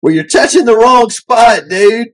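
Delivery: heckling passenger. Retold or imitated. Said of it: "a guy in the back goes".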